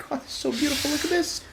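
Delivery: giddy falsetto admiration; excited squeal. Real, not acted. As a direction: squeal with delight over the screen. Gushing over new laptop.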